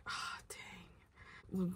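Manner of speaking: barely voiced, deflated sigh of words; hushed letdown; whispered dismay. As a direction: let it out under your breath, defeated. Deflated reaction on finding only tire.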